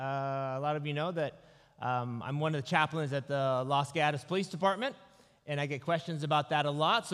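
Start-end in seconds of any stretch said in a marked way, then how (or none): none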